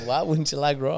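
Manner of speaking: normal talking voice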